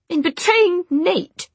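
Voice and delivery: high, loud, slow indignant snap; snapping. Acted. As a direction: snap back, outraged. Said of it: "Jessamine snapped".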